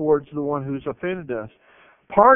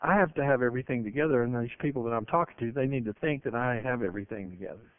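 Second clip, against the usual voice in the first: inner thought; 5 semitones lower, 6 dB quieter, faster than usual. Retold or imitated. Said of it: "he's thinking".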